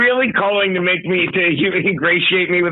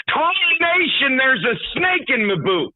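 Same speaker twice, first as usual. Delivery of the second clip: cartoon cowboy voice; 7 semitones higher than usual. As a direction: play the goofy cowboy. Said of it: "talking like a cartoon cowboy".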